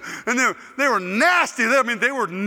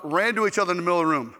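ordinary delivery

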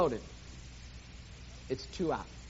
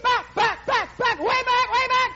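announcer shout; 13 semitones higher, 7 dB louder than usual. Acted. Preacher as announcer calling the fly ball, shouting. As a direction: shout the ball over the fence.

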